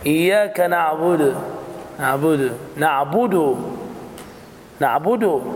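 نعبد with the final dal rounded and clearly voiced as "du"; clear damma on the dal. Shown as a demonstration. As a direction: repeat نعبد, stressing the "u" of the dal. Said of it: "بيان ضمة الدال".

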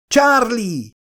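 high, desperate cry of his name; desperate scream. Acted. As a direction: scream for him as he's swept away. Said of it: "üvöltötte a kutatónő kétségbe esetten". (kétségbeesett, magas kiáltás)

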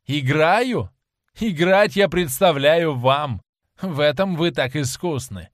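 venomous. A narrator's reading. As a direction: throw it back with biting sarcasm. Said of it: "ядовито ответил он". (ядовитая, язвительная реплика)

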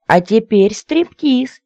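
high, loud, drawn-out squeal. A narrator's reading. Lady to suitor on the bed, squealing. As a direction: announce it in a gleeful squeal. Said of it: "И снова визг".

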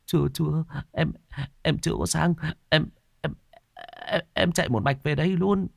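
slapped younger brother answering, whimpering. Acted. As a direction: stammer like about to cry. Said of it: "mếu máo".

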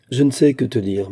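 usual speaking voice

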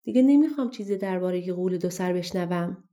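ordinary delivery, nothing marked

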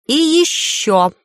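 slow, drawn-out, high and ominous; ominous pause. A narrator's reading. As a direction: draw out И ещё with a menacing pause. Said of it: "выдержав зловещую паузу".

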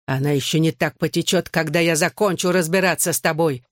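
usual speaking voice

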